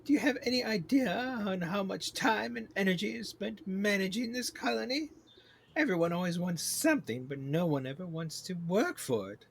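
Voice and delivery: high, imperious queen bee voice; exasperated queen bee. Acted. Queen bee scolding over managing this colony, exasperated.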